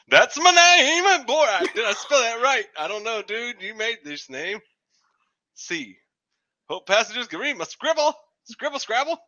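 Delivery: put-on high-pitched old-time actor patter; thirties actor. Rookie introducing himself on the train, thirties actor voice. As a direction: play it like a thirties movie actor. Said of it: "I'm like a thirties actor".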